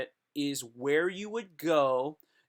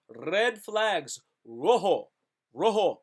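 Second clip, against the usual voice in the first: dramatic announcer; 3 semitones higher, 4 dB louder, much slower than usual. Pretend. Segment title announced dramatically.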